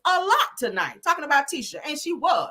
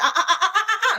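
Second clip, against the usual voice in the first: laughing refusal; 10 semitones higher, faster than usual. Retold or imitated.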